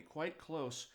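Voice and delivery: usual speaking voice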